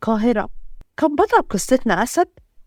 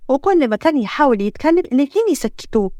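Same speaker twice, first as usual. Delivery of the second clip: ordinary delivery, nothing marked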